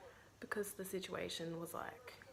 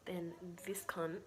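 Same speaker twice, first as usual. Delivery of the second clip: ordinary talk, nothing marked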